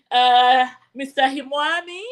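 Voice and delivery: ordinary talk, nothing marked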